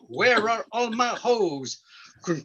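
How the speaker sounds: rhythmic farmer rap with drawn-out refrain; chanted comic farm poem, punching the refrain; mock urban poetry. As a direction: recite it like a corny hayseed trying to rap. Farmer performs his urban poetry.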